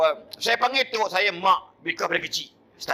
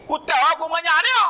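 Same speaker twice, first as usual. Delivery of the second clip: put-on much higher, louder old-woman retort; grandmother arguing; 13 semitones higher, 5 dB louder than usual. Grandmother denying to the uncle, high and insistent. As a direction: argue back like a stubborn granny.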